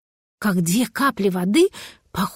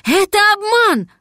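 loud, high-pitched cry; shouted warning; 10 semitones higher, 5 dB louder than usual. A narrator's reading. Cat warning the little dragon of a trick, high shout. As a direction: shout it out in alarm, pitch leaping up. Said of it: "крикнул кот".

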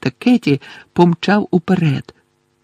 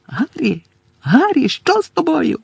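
high-pitched urgent call; Angelina's cry; 11 semitones higher than usual. A narrator's reading. Teammate calls to the seeker who stopped moving, crying out. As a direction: cry out in alarm, without much volume. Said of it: "закричала Анжеліна".

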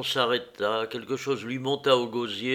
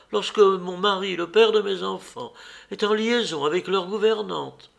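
higher, louder indignant accusation; raised angry voice; 8 semitones higher, 4 dB louder than usual. Acted. Wife accusing her husband of the affair, indignant and loud. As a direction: hurl the accusation at him. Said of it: "en élevant la voix".

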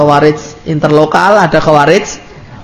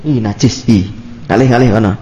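mock disgust; 7 semitones lower than usual. Preacher mimicking a wife shunning her husband, mock disgust. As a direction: shrink away in mock revulsion. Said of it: "Wong dhek wis adus resik".

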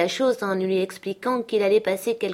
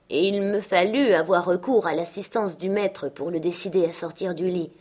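ordinary delivery, nothing marked

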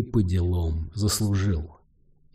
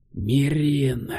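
breathed out; 5 semitones higher than usual. A narrator's reading. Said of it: "выдохнул мужчина".

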